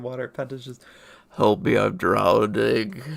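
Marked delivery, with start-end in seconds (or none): 1.4-3.0 low, loud, drawn-out mock plea; hammed-up slow bellow for help; drowning cry. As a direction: play a drowning character, slow and hammy. Acting out Penta drowning in comic.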